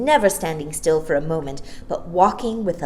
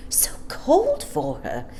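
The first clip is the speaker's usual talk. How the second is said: soft gentle mothers; 7 semitones higher than usual. Acted. Shopping mothers about the child, soft whisper. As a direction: murmur with pity. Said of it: "voices suddenly soft and gentle".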